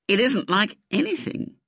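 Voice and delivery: ordinary delivery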